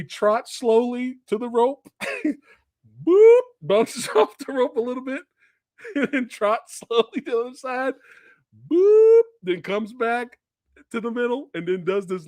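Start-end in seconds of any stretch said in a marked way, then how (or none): 3.1-3.4 loud, high bounce noise; high comic boop. Retold or imitated. Host miming [name] bouncing off the rope, comic boop. 8.7-9.2 loud, very high bounce noise; high comic boop. Retold or imitated. Host miming [name]'s second rope bounce, squeaky boop.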